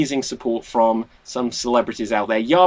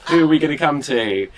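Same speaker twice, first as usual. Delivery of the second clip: normal talking voice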